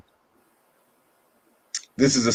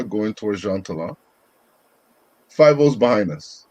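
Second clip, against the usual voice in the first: ordinary delivery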